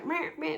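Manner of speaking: mocking yap of petty bickering; a dismissive nagging meh; sneering mimicry of petty chatter.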